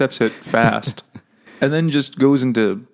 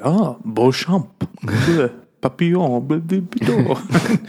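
slow, put-on French accent, going higher into gibberish; hammed-up French, trailing into blah blah; mock French accent; 4 semitones higher, slower than usual. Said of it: "the guy's like".

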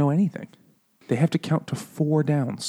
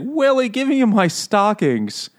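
high, drawn-out imitation of the mistress; oblivious mistress; 8 semitones higher, slower than usual. Mistress asking Willie for her stockings, high mock whine. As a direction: whine it slowly, pitched way up. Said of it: "She's like".

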